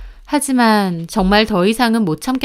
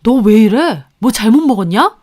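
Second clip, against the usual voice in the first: annoyed husband; 4 dB louder than usual. A narrator's reading. Husband snaps at narrator in quarrel.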